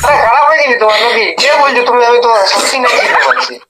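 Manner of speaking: ordinary talk, nothing marked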